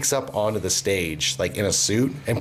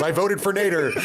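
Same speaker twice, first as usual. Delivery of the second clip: mock yell; 6 semitones higher than usual. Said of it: "just yells".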